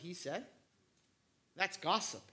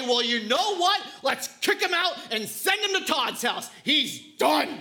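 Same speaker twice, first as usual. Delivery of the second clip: mock dad verdict; 5 semitones higher, 8 dB louder than usual. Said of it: "expecting me to say".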